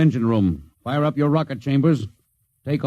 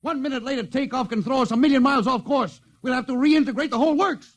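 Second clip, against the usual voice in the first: angry outburst; 10 semitones higher than usual. Acted. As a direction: lash out at ground control. Captain angry at ground control.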